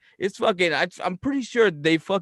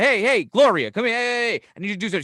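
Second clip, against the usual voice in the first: loud, higher summons to an employee; calling out; 7 semitones higher, 6 dB louder, faster than usual. Acted. Boss summoning Gloria to a task, insistent calling out. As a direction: call her over quickly, rushing the request.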